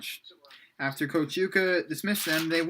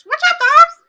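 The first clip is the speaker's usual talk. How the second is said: very loud squealed greeting; high-pitched voice; 16 dB louder than usual. A narrator's reading. Wolf boy greets the group, extremely high-pitched voice. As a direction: push it to an extreme high shriek. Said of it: "he said in a high-pitched voice".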